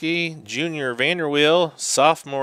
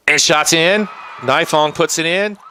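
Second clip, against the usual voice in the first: excited goal call; 5 semitones higher, 5 dB louder than usual. Real, not acted. Goal scored, live commentary.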